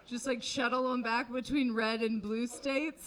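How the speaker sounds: ordinary talk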